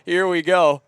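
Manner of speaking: giggling, gearing up to try it; nervous laugh before a bit; laughing through the words; laughing nervously. Real, not acted. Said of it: "I am cooked".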